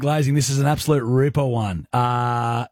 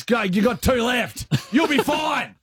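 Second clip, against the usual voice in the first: excited; 8 semitones higher, slower than usual. Real, not acted. Teasing cheer, going higher.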